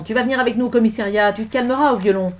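forceful arresting officer; stern threat. Acted. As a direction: lay down the law loudly.

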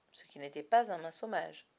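plain reading voice

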